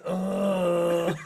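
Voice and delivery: long drawn-out groan; retold pained groan. Retelling how he lay on the bed with a cramp, drawn-out mock groan.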